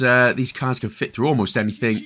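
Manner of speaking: ordinary talk, nothing marked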